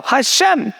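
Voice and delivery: high-pitched, insistent reply; emphatic answer. Retold or imitated. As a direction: imitate a daughter insisting on her answer. Daughter insists on her answer.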